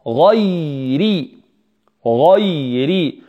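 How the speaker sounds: غيري slowly, heavy غ moving into light ي and ر; heavy then light. Shown as a demonstration. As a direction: stretch غيري from a dark start to a thin "ri". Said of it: "ثم ننتقل مباشرة إلى الترقيق". (نطق غيري بتفخيم الغين ثم الترقيق)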